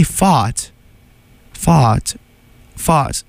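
"fought" as fart with the r dropped; isolated demo of "fought" with an open vowel; accented fart. Shown as a demonstration. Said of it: "saying fart with an accent".

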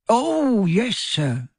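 high, eager elf voice; earnest squeaky elf; Dobby, earnest. A narrator's reading. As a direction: answer high with great earnestness. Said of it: "said Dobby earnestly".

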